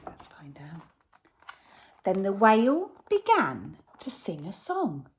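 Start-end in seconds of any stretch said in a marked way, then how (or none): 0.1-0.8 whisper. Real, not acted. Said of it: "reader hushes children for suspense".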